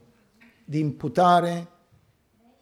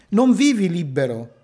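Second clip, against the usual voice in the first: normal talking voice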